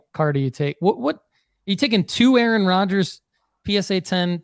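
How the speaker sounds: ordinary delivery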